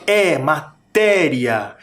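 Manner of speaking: "matéria" slowly, with an open, stressed É; strong open sound. Shown as a demonstration. Teacher contrasting the acute accent with nasal, open stretched "matéria". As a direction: push the É of "matéria" out through the mouth, drawn out. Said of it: "ele sai pela boca bem forte".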